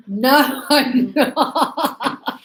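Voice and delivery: laughing through the correct answer. Shown as a demonstration. Teacher laughing as she models the answer. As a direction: chuckle while saying it.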